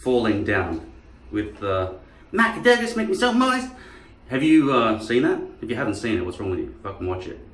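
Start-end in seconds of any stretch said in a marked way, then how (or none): 2.3-3.7 higher mock villain voice; movie line impression. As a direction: quote the film creepily. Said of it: "And it goes like this".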